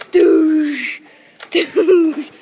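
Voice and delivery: loud falling blast noises; imitated gunshots. Soldier shooting at a zombie, loud gunshot noises. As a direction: make big play-gun bangs. Pretend firefight.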